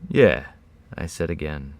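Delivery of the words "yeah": short reply with some strength; louder, slightly recovered voice; a little stronger. A narrator's reading. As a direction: answer more firmly, falling at the end. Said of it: "a little more strength in my voice this time".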